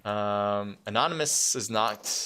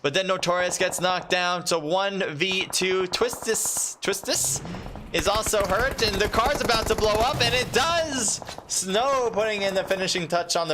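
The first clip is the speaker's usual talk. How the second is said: excited play-by-play; 8 semitones higher than usual. Real, not acted. Caster calling the squad wipe to viewers, rising excitement. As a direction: call every knock like it's the final.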